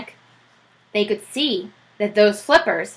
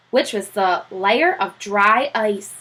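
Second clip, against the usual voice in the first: ordinary delivery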